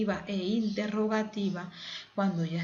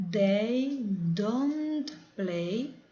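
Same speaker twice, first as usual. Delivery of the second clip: slow model; much slower than usual. Shown as a demonstration. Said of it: "teacher models English phrase".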